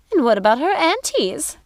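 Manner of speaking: high, eager witchy sister; sister's voice. Acted. A sister asks Gothel about the aunties. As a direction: ask it brightly like a child.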